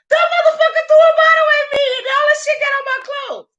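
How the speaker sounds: high, fast yelled complaint; retold outburst, hammed-up and frantic; acted indignation. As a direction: rattle it off in a high outraged squeal.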